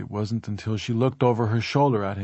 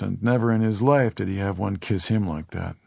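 ordinary delivery, nothing marked

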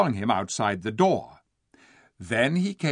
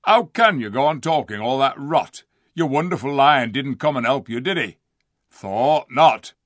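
loud, high, ranting rustic dwarf; scornful dwarf; 4 semitones higher, 8 dB louder than usual. A narrator's reading. Diggle accusing the king of lying, scornful. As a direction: jeer and rail with rough contempt.

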